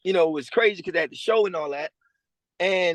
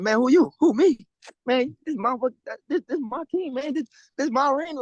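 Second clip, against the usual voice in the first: higher, mock-boastful swagger; cocky trash talk; 8 semitones higher than usual. Player trash-talking Demo at practice, higher cocky boast. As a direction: claim the field, chest out. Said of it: "I'm like".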